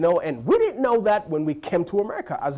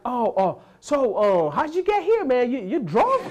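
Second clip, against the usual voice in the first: clueless American; 6 semitones higher than usual. Retold or imitated. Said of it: "It's like".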